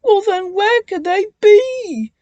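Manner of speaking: high, loud distressed cry. A narrator's reading. Twins searching for trucks, crying out high. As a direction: wail it in worry. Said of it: "the twins cried".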